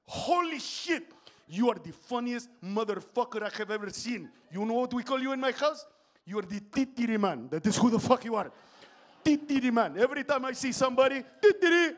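thrilled fan, punching out each phrase; excited fan. Retold or imitated. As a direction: gush loudly at meeting someone famous. Excited fan recognising comedian in story.

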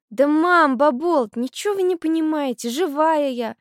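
high-pitched protesting cry; calling out. A narrator's reading. Girl insisting to mother and grandmother, crying out. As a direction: butt in on the grown-ups, pitch well up. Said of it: "крикнула Улька".

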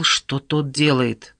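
plain reading voice

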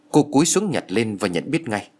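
plain reading voice

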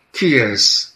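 dead wife hissing at the intruder, angry. A narrator's reading. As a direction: spit the question out furiously. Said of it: "sziszegte dühötten Dana".

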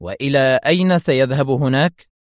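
usual speaking voice

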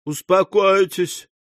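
high, slow, tremulous-hearted plea; moved almost to tears. A narrator's reading. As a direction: soothe her, nearly tearful. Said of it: "чуть не до слёз растроганный".

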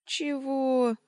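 high, loud incredulous query; astonished, scoffing question; amused disbelief. A narrator's reading. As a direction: throw it up high, half mocking. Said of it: "усмехнулся он".

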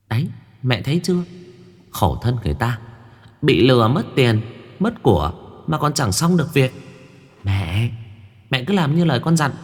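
put-on a little girl jeering at the shaman; cheeky young girl. Mười urging her mother.

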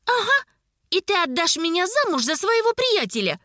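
put-on high-pitched, indignant girl; sarcastic high protest. A narrator's reading. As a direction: throw it back at your uncle, outraged. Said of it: "возмутилась рыжая".

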